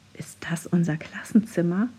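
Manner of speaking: whispered, bewildered. A narrator's reading. Child whispering to classmates after shrinking, whisper. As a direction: breathe it out, lost. Shrunken child asks in confusion.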